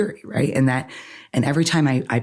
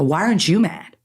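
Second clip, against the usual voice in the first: retold indignation; 3 semitones higher than usual. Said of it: "and I'm mad".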